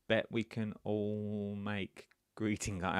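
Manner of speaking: usual speaking voice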